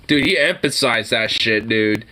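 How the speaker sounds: ordinary delivery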